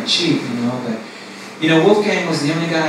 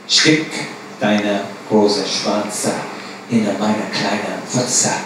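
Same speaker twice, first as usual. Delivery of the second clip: slow, lower German line; low, dragged-out command; pimp's demand; 7 semitones lower, 4 dB quieter, much slower than usual. Retold or imitated. Pimp dictating the phrase to the girls, slow and low. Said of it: "Repeat after me".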